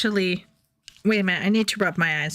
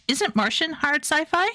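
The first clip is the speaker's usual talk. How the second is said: ordinary talk, nothing marked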